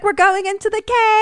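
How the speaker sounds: high, loud mock battle cry; hammed-up, high-pitched excited holler; imitated thrilled cry. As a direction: call it out as if leaping. Acts out pretend jump over cliff.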